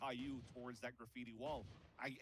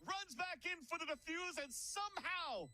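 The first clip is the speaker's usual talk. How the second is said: high, rising hype clutch call; esports caster; slower than usual. Acted. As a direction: build the call to a big finish. Climactic clutch call in the round.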